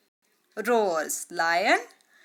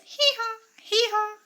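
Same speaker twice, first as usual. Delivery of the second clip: donkey bray. Shown as a demonstration. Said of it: "Donkey says".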